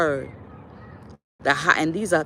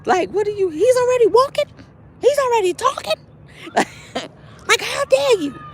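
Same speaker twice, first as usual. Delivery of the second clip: high, loud mock outrage; elder lady lamenting missed milestones; dismayed elder; 4 dB louder than usual. Elder scolds young mother, high mock outrage. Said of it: "she like".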